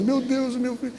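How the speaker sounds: mild mock wail, high and soft; choro imitado. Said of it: "chegou a tia do rapaz chorando". (choro leve imitado, voz aguda)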